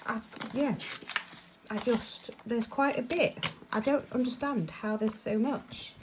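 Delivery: usual speaking voice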